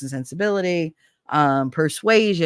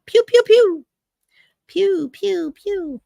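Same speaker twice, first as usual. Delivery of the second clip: laser gun noises; 5 dB louder, slower than usual. Retold or imitated. Imitating gunfire.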